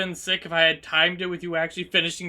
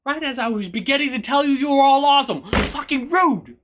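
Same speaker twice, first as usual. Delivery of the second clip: loud, high, exasperated protest; indignant complaint; 5 semitones higher, 4 dB louder than usual. Real, not acted. Streamer interrupted while telling viewers they are awesome, indignant outburst. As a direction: protest loudly, stretching the last words.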